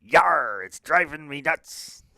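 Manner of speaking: loud hammy pirate growl on Yar. Acted. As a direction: ham it up like a cartoon pirate. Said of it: "The pirate says".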